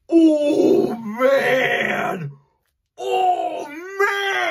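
loud high thrilled cries; excited. Real, not acted. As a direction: yell with pure joy. Thrilled at the book reveal.

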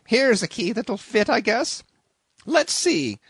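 high, loud flustered innkeeper; fretting landlady. A narrator's reading. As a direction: rattle on like a panicked landlady. Landlady voice held.

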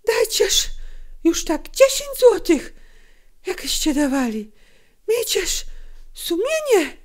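high, loud, breathy plea; agitated mother imploring; desperate pleading. A narrator's reading. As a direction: beg urgently for a fairer price.

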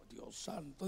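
very soft, low exclamation; dismayed aside. Retold or imitated. Pastor hears the brother wants another, dismayed aside.

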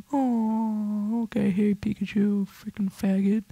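soft high breathy jeer; whispered taunt. Acted. Gamer secretly taunting his Pikachu in a soft high whisper. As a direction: hiss a sneaky taunt.

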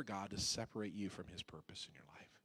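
soft whispered plea; hushed pastoral whisper. Acted. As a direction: breathe the line like a secret. Soft close of sermon plea.

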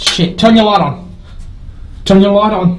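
loud urgent high orders; panicked shouting. Real, not acted. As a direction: shout in fear. Demanding light in panic.

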